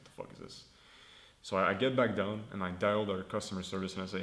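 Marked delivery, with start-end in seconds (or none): 0.1-0.6 unvoiced whispered aside to himself. Lost upstairs in a staff canteen, whispering in confusion. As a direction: breathe it out, baffled, under your breath. Confused, finding employee dining area.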